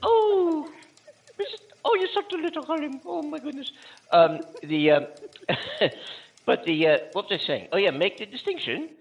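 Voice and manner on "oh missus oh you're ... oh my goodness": very high falsetto, cooing fondly; Missus Doubtfire. Acted. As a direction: fuss over a darling in a hammed-up old-lady voice. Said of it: "Yes, Missus Doubtfire".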